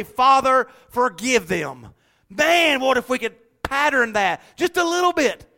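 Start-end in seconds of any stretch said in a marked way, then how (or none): 0.0-1.9 loud, slow, drawn-out cry to the Father; fervent plea. Shown as a demonstration. Preacher voicing Jesus on the cross, loud slow plea. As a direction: call out the words with full preaching force. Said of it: "enough love to say".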